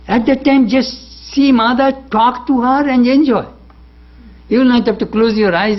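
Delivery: lifted, eager urging to just enjoy; high, bright push to simply enjoy; excited urging. Real, not acted. Preacher urging listeners to enjoy Mother's presence, excited.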